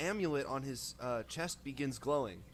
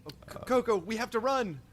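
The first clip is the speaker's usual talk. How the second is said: high, loud urgent warning; urgent alarm; 9 semitones higher, 8 dB louder than usual. Acted. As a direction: hurry them out before it falls. Urgent warning in collapsing temple.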